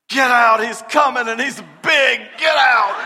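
loud, high panicked shout; panicked yell. Retold or imitated. Boy warning the driver to flee, loud panicked yell. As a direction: urge the driver away in a panic. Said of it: "I says".

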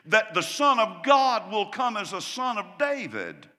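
loud emphatic preacher's voice; preaching. Acted. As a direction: hammer the teaching home. Preaching the point.

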